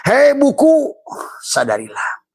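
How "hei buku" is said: very high, loud comic call; mock call. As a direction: hail the book like a joke. Calling out to the book.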